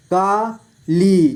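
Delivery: काली split slowly into का, ली; syllable by syllable. Shown as a demonstration. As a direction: stretch and separate का and ली in Kali. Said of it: "इस तरह से आपको". (काली को तोड़कर धीरे: का, ली)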